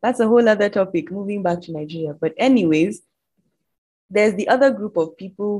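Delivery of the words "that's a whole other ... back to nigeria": normal talking voice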